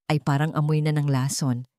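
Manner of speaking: plain reading voice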